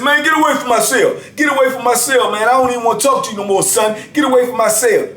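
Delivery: high, dismissive yelling; mock angry. Inmate telling narrator to leave his cell, high mock yell. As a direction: wave him off hotly.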